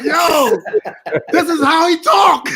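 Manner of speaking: loud, high and slow, half laughing; amused shout. Real, not acted. Telling the friends about X, laughing loudly. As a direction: shout it out while cracking up. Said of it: "laughing shout".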